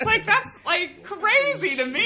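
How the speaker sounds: high, loud, laughing disbelief; incredulous. Retold or imitated. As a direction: laugh it out, amazed at them. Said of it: "like, shocked".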